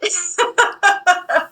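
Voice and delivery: high burst of giggles; real, rippling laughter; self-mocking laugh. Real, not acted. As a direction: laugh at your own failure. Laughs at her own rejection.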